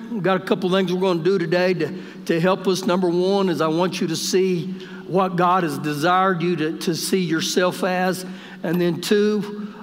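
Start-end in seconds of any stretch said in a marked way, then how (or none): none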